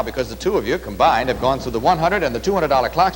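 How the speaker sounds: ordinary talk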